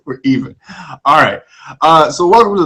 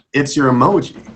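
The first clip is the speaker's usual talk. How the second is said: normal talking voice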